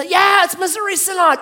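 high, loud acted reply; hanging man shouting. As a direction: holler the answer up the rope.

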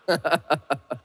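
quick amused chuckle; short laugh. Real, not acted. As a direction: laugh at your own joke. Preacher laughs at own joke.

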